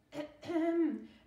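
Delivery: loud, high, deliberate ahem; throat clearing. A narrator's reading. Attendant before addressing a guest, showy throat clearing. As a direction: clear your throat for show. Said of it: "clearing my throat".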